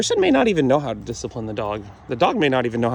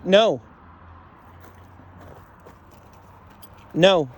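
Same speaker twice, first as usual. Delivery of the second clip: firm correction; 6 semitones higher, much slower than usual. Real, not acted. Trainer makes Frida hold a sit, firm correction. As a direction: insist on it, word by word.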